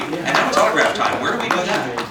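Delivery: normal talking voice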